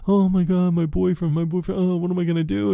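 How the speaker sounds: high-pitched whiny lament, imitated; mock whining. Mocking Amy's fretting about her boyfriend, high whiny imitation. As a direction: mimic a fretting girl in a high voice.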